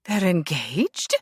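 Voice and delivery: slow, appalled disbelief; horrified question. A narrator's reading. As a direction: let the horror sink in on each word. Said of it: "with no small amount of horror".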